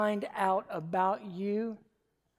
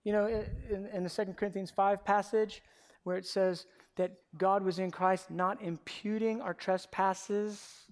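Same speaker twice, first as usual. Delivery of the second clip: normal talking voice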